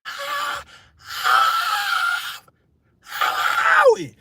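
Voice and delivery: high drawn-out panicked cry; comic panic. Retold or imitated. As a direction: wail in mock terror.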